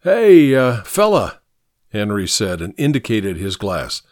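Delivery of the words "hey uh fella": loud, high hey, then easing; raised-voice call across the room; calling after someone. Acted. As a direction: hail a man walking away. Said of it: "Henry called after him".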